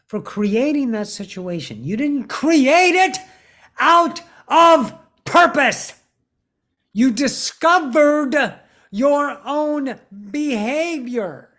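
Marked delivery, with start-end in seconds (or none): 1.8-11.5 slow emphatic reassurance. Real, not acted. Reassuring listener it's not their fault.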